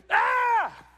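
high laughing cry, then a shout; laughing shout of awe. Acted. Preacher acting awe at God's glory with a loud laughing shout. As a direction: burst out laughing and crying out.

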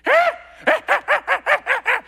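high, loud ape chatter; monkey calls. Retold or imitated. Ape at the water calling at the teens, loud high chatter. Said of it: "does it".

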